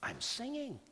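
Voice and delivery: high, soft, stunned by his own singing; amazed whisper-soft. Retold or imitated. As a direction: marvel that the voice came back. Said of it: "I'm going".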